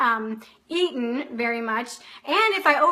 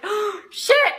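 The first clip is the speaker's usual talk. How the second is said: retold dismay; 7 semitones higher, 3 dB louder than usual. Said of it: "I'm like".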